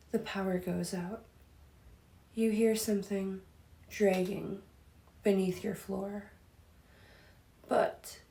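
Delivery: hushed horror-story telling, set up the scare; spooky story narration. Acted. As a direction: hush it down like a campfire ghost story. Reads spooky scenario.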